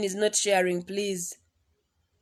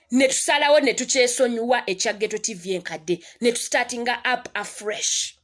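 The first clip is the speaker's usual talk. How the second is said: ordinary talk, nothing marked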